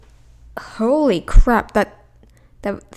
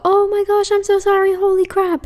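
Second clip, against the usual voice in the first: much higher, gushing fake sympathy; mock pity; 9 semitones higher than usual. Streamer mocking viewers who pity her eyesight, high and gushing. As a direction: overdo the pity like a gushing fan. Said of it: "do not come, like".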